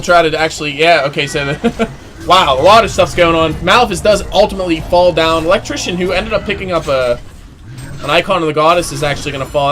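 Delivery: loud, high, rapid-fire caster call; live teamfight commentary, voice up and driving; excited play-by-play. Acted. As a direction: ride the teamfight hype for the crowd.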